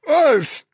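very high, startled cry of revulsion; disgusted yelp. A narrator's reading. Dennis pushing the licking thing away, revolted yelp. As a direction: yelp as a tongue licks your face. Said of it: "trying to push the thing away".

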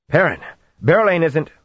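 urgent shout. Acted. Intruder bursts through the door shouting for Perrin.

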